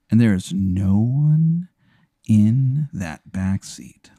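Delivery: slow, hushed ghost-story telling; spooky narration. A narrator's reading. Teller spooks his friend, slow and eerie. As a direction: draw it out to build dread.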